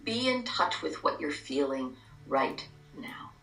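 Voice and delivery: calm guided meditation, hushed. Acted.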